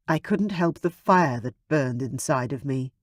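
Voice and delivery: ordinary delivery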